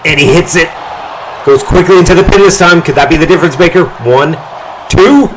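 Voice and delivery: high, loud, fast pin count; excited call. Real, not acted. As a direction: climb to a frantic two. Calling a near-fall pin.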